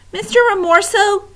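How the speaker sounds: high, tearful plea; crying. Acted. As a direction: beg through tears. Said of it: "he was crying now".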